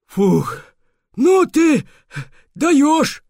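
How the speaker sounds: high, breathless character voice; out of breath beaver. Acted. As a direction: gasp it out after running. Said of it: "пробормотал он". (запыхавшийся бобёр)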